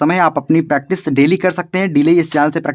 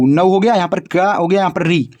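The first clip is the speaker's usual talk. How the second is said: normal talking voice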